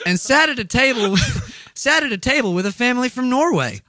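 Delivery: high Southern voice breaking into laughter; Southern accent. Acted. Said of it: "in a Southern accent".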